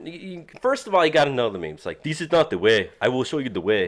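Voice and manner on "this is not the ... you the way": put-on Ugandan Knuckles meme accent; put-on Ugandan accent, lower on the way. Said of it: "I kinda wanna read this with the Ugandan accent".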